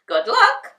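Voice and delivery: high loud gatekeeper. A narrator's reading. Gatekeeper wishes them luck, high and loud. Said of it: "said the gatekeeper".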